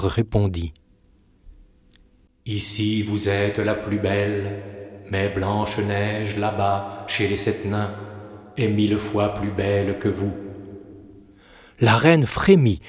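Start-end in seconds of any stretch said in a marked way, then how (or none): 2.5-10.3 an oracle gives the verdict gently; magic mirror voice. Acted.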